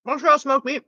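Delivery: talking through a mouthful; words round a bite of sandwich; mouth full. Real, not acted. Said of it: "I talked to my mouth full".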